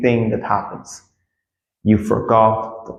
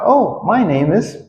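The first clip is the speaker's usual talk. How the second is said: higher, acted-out "oh" reply; lilting, pitched-up reply; other person's reply; 8 semitones higher than usual. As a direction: play the other person answering. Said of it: "other person will always reply".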